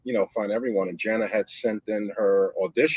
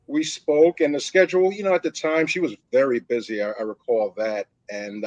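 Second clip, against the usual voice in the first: ordinary talk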